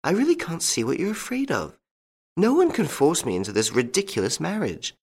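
put-on high-pitched young woman's voice, coaxing; reasoning daughter. A narrator's reading. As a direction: talk sense into a worried father, pitched well up. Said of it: "trying to make him listen to common sense".

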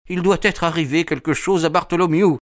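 high-pitched, alarmed character voice; anxious man calling out, high; frightened cry. A narrator's reading. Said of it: "cria-t-il".

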